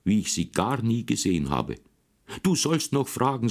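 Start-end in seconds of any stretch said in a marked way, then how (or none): 2.4-3.5 higher, sharp scolding mother; angry mother. Acted. Mother scolds her son, mildly angry. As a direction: scold the boy in a mild rage. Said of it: "Da wurde meine Mutter so zornig".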